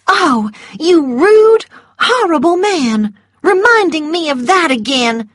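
high, loud and outraged; indignant. A narrator's reading. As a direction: act hurt and scandalised. Scarlet offended by Rhett.

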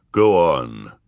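low, deep commanding voice; drawn down deep in pitch; low deep judge. A narrator's reading. A judge urging the orphans on, low and deep. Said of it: "said a low, deep voice".

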